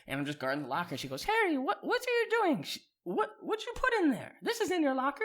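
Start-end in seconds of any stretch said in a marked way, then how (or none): 1.2-5.2 high-pitched mock Spanish-accented woman; Miss Lucatina, Spanish accent. Teacher questioning Harry at his locker, high mock Spanish accent. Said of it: "Thick Spanish accent, real sexy redhead".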